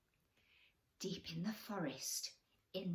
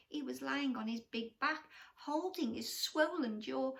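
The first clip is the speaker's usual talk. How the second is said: plain reading voice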